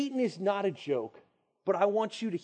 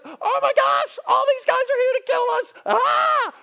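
high, loud, panicked yell; panicked servant; 10 dB louder than usual. Retold or imitated. Servant shouting to Elisha about the army outside, panicked yell. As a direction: shriek it like the enemy army is at the door. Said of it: "He's, like, freaking out".

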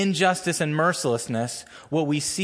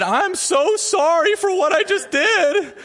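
put-on sorry kid; 4 dB louder than usual. Kid begging, laughing teller.

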